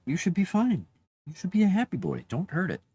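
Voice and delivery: high, swooping reassurance to a pet; pet talk. Real, not acted.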